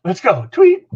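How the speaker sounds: high, loud call with a spoken whistle; Dick Adams. Retold or imitated. Coach starting the play from afar, high loud call. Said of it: "I hear him say".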